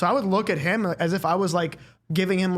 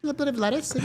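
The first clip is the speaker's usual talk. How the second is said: high, prissy little order; dainty princess voice; 9 semitones higher than usual. Retold or imitated. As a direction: mince it like a delicate princess. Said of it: "he sounds like a fucking, like, princess".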